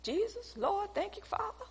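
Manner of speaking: high, soft, breathy relieved thanks; relieved prayer. Retold or imitated. Believer relieved after apologizing, high and soft. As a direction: sigh it up to God, high and hushed. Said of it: "You'll be like".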